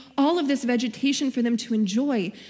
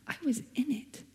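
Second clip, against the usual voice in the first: God's gentle answer; 5 dB quieter than usual. Retold or imitated. God answering her complaint, soft and tender. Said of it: "And he told me".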